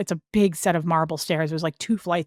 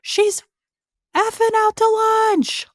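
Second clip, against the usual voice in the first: very high, piercing screamed imitation; full high-pitched yell, imitating an enraged woman; mocking scream; 4 dB louder, slower than usual. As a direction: scream it derisively, drawing out the last words. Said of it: "screamed it like it was me".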